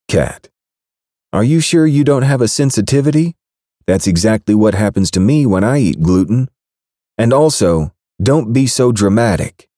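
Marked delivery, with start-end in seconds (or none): 1.3-9.7 much higher, innocent and sing-song; playing dumb sweetly; coy woman's voice. Baker texting the angry rancher, coy innocent voice. Kat brushing him off.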